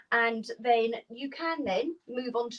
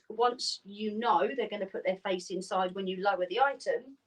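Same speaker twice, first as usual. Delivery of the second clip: normal talking voice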